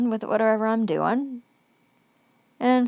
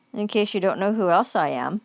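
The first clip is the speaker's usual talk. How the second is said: ordinary talk, nothing marked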